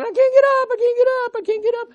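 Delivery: high squeaky cry of a sheep stuck on its back; mock pleading sheep, very high and loud; helpless sheep.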